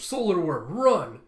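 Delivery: high, loud yell of alarm; urgent warning shout. Acted. Effie warns of giant worm.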